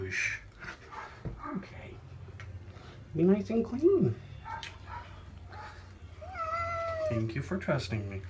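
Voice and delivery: soft high coaxing pet talk; soothing a scared cat. Real, not acted. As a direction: reassure and praise a nervous kitty. Calming cat after the bath.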